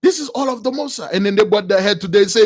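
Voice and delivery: usual speaking voice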